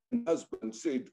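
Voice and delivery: ordinary delivery, nothing marked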